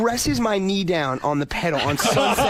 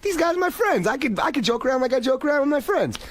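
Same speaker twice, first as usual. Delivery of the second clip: cocky inner voice; 8 semitones higher, faster than usual. Retold or imitated. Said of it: "And I'm like".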